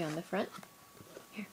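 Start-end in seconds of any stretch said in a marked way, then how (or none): none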